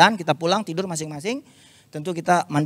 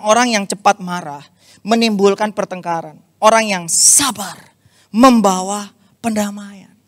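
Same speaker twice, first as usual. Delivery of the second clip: scripture reading; 3 semitones higher, 5 dB louder than usual. Acted. Membacakan ayat Amsal.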